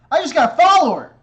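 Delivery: high, loud and fast; thrilled shout at a follower; excited. Real, not acted. Excited about a new follower.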